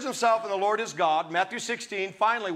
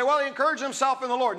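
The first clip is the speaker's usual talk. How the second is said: quick, careless misquote; misquoting people; 4 semitones higher, faster than usual. Retold or imitated. Preacher mimics people's quick misquote. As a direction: rush it off like a common misquote. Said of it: "No, it doesn't say that".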